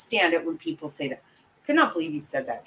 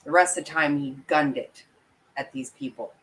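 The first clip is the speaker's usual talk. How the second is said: normal talking voice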